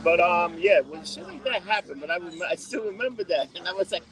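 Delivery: usual speaking voice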